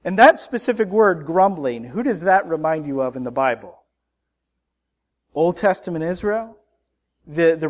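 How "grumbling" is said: usual speaking voice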